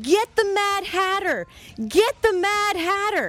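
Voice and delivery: imitating mom yelling at the pitch. Son imitates his mom yelling at the soccer game, high mock yell. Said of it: "So she's screaming out".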